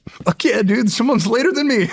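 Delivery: thrilled someone else is late; excited. Retold or imitated. Plebe relieved someone is later, excited. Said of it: "I'm like".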